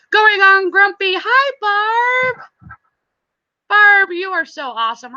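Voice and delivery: very high, gushing hello; real high-pitched enthusiasm; excited greeting. Real, not acted. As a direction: greet friends with a high, bright lift. Greeting arriving chat members.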